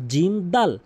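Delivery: normal talking voice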